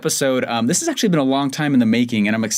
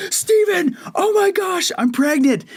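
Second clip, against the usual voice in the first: excited wife; slower than usual. Retold or imitated. Wife running to Steven with the test, higher excited squeal. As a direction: play the wife bursting out of the bathroom. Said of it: "she goes".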